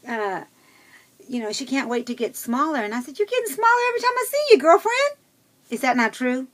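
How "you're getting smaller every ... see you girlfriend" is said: very high, loud, rapid-fire gush; excited exclamation. Retold or imitated. Retold cheer to friend losing weight, high fast excited exclamation. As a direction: squeal it in a fast, high rush.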